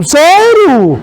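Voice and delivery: high, loud, slow fake astonishment; mock surprise. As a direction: gush with exaggerated surprise to the pregnant woman.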